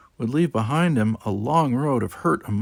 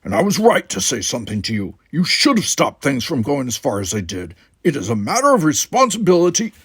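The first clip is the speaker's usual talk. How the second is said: louder, rising stern reproof; stern lecture; 4 semitones higher, 6 dB louder than usual. Acted. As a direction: lecture her on duty.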